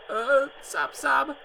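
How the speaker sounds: put-on high girl sobbing, words spoken; a mock sob in a high voice; spoken sobs in a high girl's voice. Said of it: "broke into convulsive sobbing".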